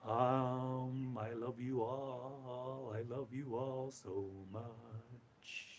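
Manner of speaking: very soft, slow, low murmur; hushed, lingering loving croon, fading away; unconditional love. Pretend. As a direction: radiate tender love, drawing out each word. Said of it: "Feel the resonance of unconditional love".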